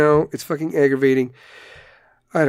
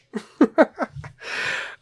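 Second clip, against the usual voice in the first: high airy giggle; giggling laugh; 10 semitones higher, 3 dB quieter than usual. Real, not acted. Host cracking up at his own dirty joke, high airy giggle. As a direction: let out a high breathless giggle. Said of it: "after the trunk joke".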